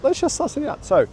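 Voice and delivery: usual speaking voice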